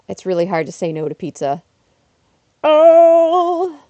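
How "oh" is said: sudden loud high "Oh!"; surprised gasp. Real, not acted. Streamer spotting something on screen, surprised.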